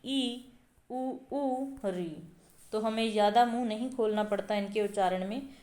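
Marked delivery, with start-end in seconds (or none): 0.0-2.3 slow, soft "ई, उ, ऊ, री" with mouth nearly shut; closed vowel demo. Shown as a demonstration. Teacher demonstrating closed vowels to students, slow and soft. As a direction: stretch each vowel slowly, lips barely parted. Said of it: "जैसे ई".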